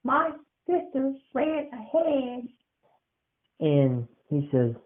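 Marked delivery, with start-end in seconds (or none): 0.0-2.5 put-on high slow anxious kid voice; a child missing his sister; high boyish fret; worried little boy. Kid explains.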